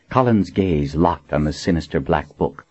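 usual speaking voice

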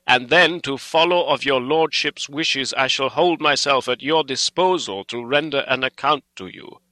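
put-on loud, emphatic old doctor, weighing phrases; Van Helsing, stern and earnest. A narrator's reading. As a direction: press the appeal with solemn force.

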